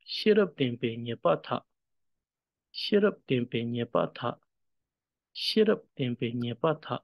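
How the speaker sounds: "Shirap tempe nyepa tha" as one connected line; flowing line reading of "Shirap tempe nyepa tha". Shown as a demonstration. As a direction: run the words of "Shirap tempe nyepa tha" together smoothly. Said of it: "here it's more in the flow".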